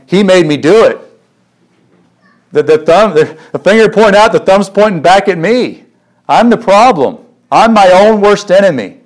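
high, driving pulpit intensity; full-force sermon climax; impassioned preaching. Acted. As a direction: preach it hard to the congregation.

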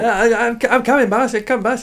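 loud high reply; shouting back. Acted. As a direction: call out to reassure. Answering boss in shout.

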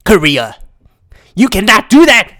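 dramatic, loud tweet reading; Stephen A. Smith. Acted. Host reads a pundit's tweet aloud, dramatic and emphatic. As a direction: punch each word like an outraged sports pundit. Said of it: "dramatic reading that I had to do of Stephen A's Twitter".

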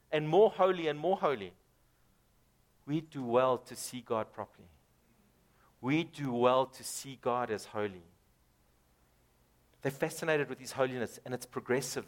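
normal talking voice

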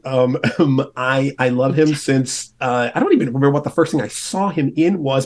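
ordinary delivery

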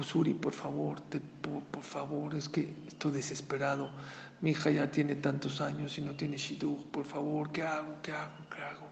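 soft, pleading, breaking into a whisper; a father's hushed desperate plea. Retold or imitated. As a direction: beg quietly, at the end of your rope. Desperate father begs for help.

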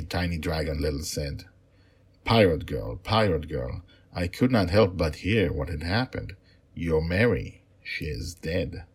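steady storytelling voice for a bedtime tale; story narration. A narrator's reading. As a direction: read it like a father telling his girls a story. Father reads his story aloud.

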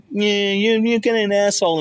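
high-pitched mock wife voice; imitating wife. Wife telling husband off, imitated in a high mock voice. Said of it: "she gives me that, like".

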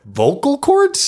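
high, slow, baffled echo of the question; repeating the odd words in disbelief; incredulous. Real, not acted. Host repeats the listener question in disbelief.

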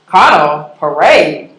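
loud, stunned surprise; shocked disbelief. Retold or imitated. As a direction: react like you just found out it's carnival. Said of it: "I was like".

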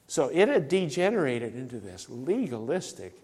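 usual speaking voice

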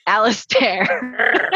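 slow dreamy name; swooning. Acted. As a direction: sigh the name lovingly.

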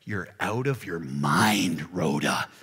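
low, slow, dismissive voice; doubting. Acted. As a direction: scoff at her. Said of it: "And they say this".

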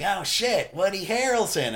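high-pitched, astonished retold exclamation; startled recognition. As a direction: mimic a friend suddenly spotting a star. Acting friend's startled recognition.

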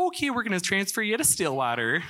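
a hammed-up, affectionate Minnesota accent. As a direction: tease the local Minnesota accent with a laugh. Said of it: "Or maybe their accents were".

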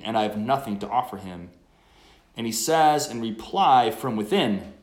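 loud, measured scripture reading; gospel proclamation. Acted.

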